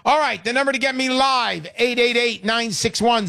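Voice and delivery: ordinary talk, nothing marked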